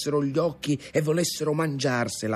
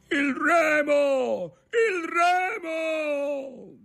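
high, very long-drawn yell; shouted call; much slower than usual. A narrator's reading. Ntoni calling for the oar in rough sea, shouting. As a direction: bellow each call, stretching it out. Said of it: "gridò Ntoni".

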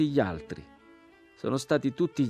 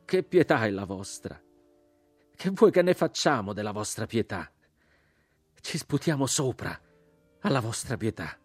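raised, contemptuous cry; sudden outcry; 4 semitones higher, 3 dB louder than usual. A narrator's reading. Crucified man rejects the rider's pity, sudden outcry. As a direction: throw the pity back with disgust. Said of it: "all'improvviso gridò".

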